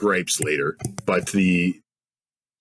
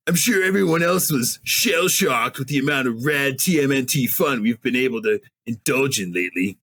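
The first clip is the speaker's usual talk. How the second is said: Rocksteady voice; 5 semitones higher than usual. Acted. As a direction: ham up a goofy rhino henchman. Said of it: "you gotta say it in Rocksteady's voice".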